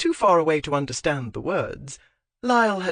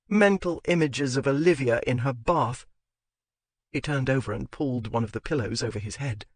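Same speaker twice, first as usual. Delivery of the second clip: ordinary delivery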